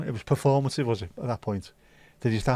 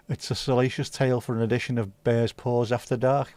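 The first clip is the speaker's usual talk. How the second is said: ordinary talk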